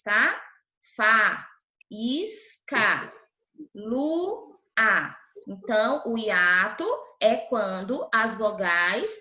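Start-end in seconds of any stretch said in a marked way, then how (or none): 0.9-3.1 "Fa, is, ca" with a gap after each syllable. Shown as a demonstration. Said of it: "em sílabas diferentes, separadas". 3.7-5.2 "lu, a" as two separate syllables. Shown as a demonstration. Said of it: "em sílabas diferentes, separadas".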